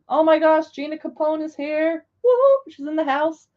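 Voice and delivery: loud, high cheer for a guest; excited welcome. Real, not acted. Welcoming Gina Capone to the stream with a cheer. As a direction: hype her arrival.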